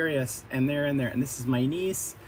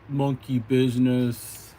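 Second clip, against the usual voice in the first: slow, menacing ape voice, louder; gorilla voice; 4 dB louder, slower than usual. Acted. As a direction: rumble it out like a mischievous ape. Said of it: "the gorilla's like".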